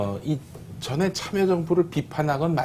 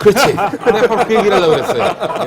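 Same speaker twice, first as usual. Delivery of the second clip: laughing; 9 semitones higher, 12 dB louder than usual. Real, not acted. Laughing agreement with co-host's joke.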